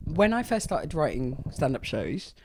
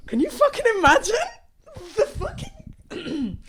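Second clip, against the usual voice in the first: much higher, louder incredulous outburst; real outrage, half laughing; exasperated disbelief; 8 dB louder than usual. Real, not acted. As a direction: throw it up in disbelief. Exasperated at hero's comment.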